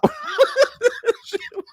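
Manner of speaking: helpless high giggles; real high laugh. Real, not acted. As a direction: burst of high-pitched laughter. Cracks up at own parody.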